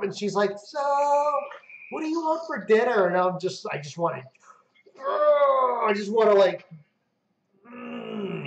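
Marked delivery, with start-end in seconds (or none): 0.6-3.1 breezy wife. Retold or imitated. Wife calmly changing subject. 5.0-8.4 strangled frustration. Retold or imitated. Holding back rage at game.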